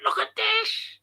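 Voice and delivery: high, squeaky imitated shout of a neighbour; a mild mock yell; mock excited shout. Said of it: "And he was shouting".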